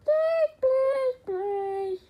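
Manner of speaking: high desperate begging; pleading. Acted. Begging viewers to subscribe.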